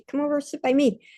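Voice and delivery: a kind come-sit-here call; inviting call. Retold or imitated. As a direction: beckon the child to sit close. Calling a hyper child over.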